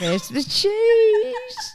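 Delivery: squealed high and slow through a laugh. Retold or imitated. Friend echoes the ad line, squealing with laughter. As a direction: shriek the line while cracking up.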